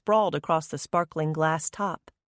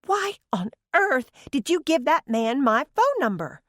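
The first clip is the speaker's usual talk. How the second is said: complaining; 9 semitones higher, 3 dB louder than usual. A narrator's reading. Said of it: "she complained".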